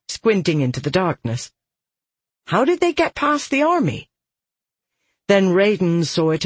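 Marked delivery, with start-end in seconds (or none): 2.5-4.1 alarmed, high woman's voice. Acted. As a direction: ask sharply, pitched up. Holly alarmed.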